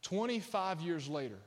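ordinary delivery

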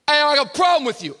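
loud, high, quick challenge; tough kid confronting. Retold or imitated. Tough young guy challenging his pastor, loud and high. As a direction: get in his face with it.

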